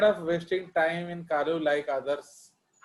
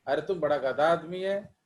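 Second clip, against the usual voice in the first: ordinary delivery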